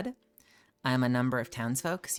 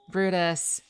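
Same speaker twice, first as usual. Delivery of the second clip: louder name call; 6 semitones higher, 4 dB louder than usual. Real, not acted. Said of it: "Did you have me at, like, A S M R level volume?".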